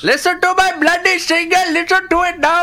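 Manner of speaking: high, loud, hammed-up Indian accent. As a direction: shout it in a classic Indian accent. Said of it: "do a classic Indian accent for us".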